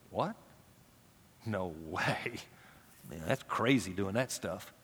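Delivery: mock scoff of a lax church; scoffing dismissal. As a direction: brush it off as absurd. Mocking churches dismissing discipline.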